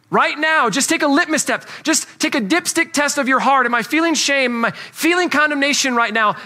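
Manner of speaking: fervent pulpit push; urgent preaching. Acted. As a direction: call the congregation to look inward. Preacher pressing the point.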